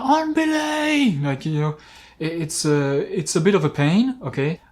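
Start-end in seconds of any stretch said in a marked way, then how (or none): 0.0-1.2 high, slow drawn-out call; mock shout. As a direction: holler it up the cliff face. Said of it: "he needs to be shouting".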